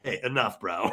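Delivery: mock annoyed Bones; imitating Bones. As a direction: push back gruffly. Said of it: "Bones like".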